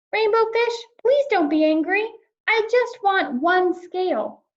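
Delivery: high, small pleading fish voice; pleading little fish. Acted. Little blue fish pleads for one scale, high and small.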